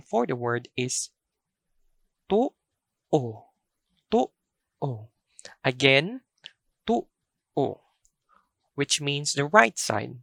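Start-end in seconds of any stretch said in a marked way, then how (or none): 2.3-5.1 tu'o with short TU, a catch, then O; glottal catch. Shown as a demonstration. Teacher showing the second pronunciation, tu'o with glottal catch. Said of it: "first vowel has a glottal catch". 6.9-7.8 tu'o with first vowel cut off, pause, then O; glottal catch. Shown as a demonstration. Teacher repeating the word for right side, tu'o with glottal catch. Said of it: "first vowel has a glottal catch".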